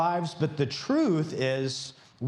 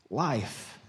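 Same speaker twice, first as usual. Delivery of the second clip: usual speaking voice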